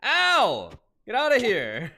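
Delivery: loud pained yell of surprise; startled yell. Real, not acted. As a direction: shout it out at the attacker. Hurt in game, yelling at mob.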